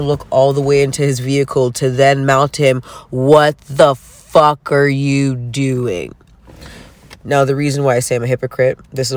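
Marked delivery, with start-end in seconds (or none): 3.1-6.1 slow, drawn-out angry yell; a touch louder, dragged-out furious question; mock road-rage yell. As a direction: stretch each word out at the other driver. Yelling at driver through window.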